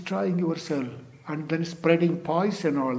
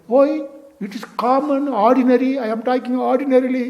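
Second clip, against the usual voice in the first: high, louder mock-indignant denial; defensive protest; 9 semitones higher, 5 dB louder, faster than usual. Partner challenged about hidden anger insists it is ordinary talk, defensive protest. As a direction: protest innocence in a raised, high-pitched voice.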